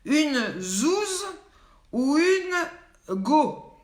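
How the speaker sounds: very slow, stretched teasing question; game host teasing the viewers, stretched out; drawn-out quiz question. Acted. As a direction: dangle each word like a riddle. Host poses slang quiz word.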